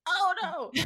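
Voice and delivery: high-pitched dismayed wail; mock horror. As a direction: wail it in exaggerated dread. Retold horror at rewatched scene.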